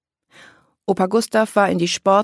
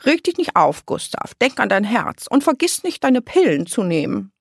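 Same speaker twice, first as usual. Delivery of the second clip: high-pitched, louder scolding grandma voice; fussing grandma; 9 semitones higher, 4 dB louder than usual. A narrator's reading. Grandma warning Gustav about his heart, fussing. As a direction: nag him anxiously about his health.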